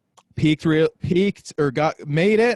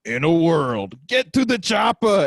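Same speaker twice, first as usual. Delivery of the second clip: trailer-voice opener leaping into high action-hero yell; impression comic; 3 semitones higher, 3 dB louder than usual. Podcaster imitating a comic after a heckle, hammy impressions. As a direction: mimic a comic falling back on his catchphrases. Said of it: "the same three impression jokes".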